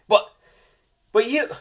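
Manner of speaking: voiced elf caught off guard; startled. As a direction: catch him off guard. Legolas startled reaction.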